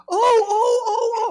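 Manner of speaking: very high, loud mock shriek; hammed-up high wails, one after another; panicky wailing. Husband imitating his wife with her coffee, panicky wailing.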